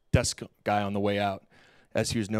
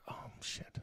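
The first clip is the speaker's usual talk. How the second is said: breathless whisper of dismay; whispered curse; 18 dB quieter than usual. Retold or imitated. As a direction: whisper it as if the floor just dropped. Hears his belongings were burned.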